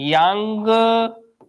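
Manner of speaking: यांगतची stretched very slowly, yaaang-ta-chee; drawn-out Chinese name. Shown as a demonstration. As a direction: draw out each part of यांगतची. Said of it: "ये Chinese लोग ऐसे ही नाम". (यांगतची को खींचकर, धीरे-धीरे बोलना)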